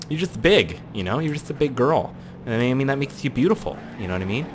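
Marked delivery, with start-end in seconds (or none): none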